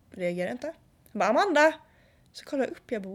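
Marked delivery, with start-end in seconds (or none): 1.3-1.7 a louder, insistent call of Amanda; calling her name louder. Retold or imitated. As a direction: nag her name across the table.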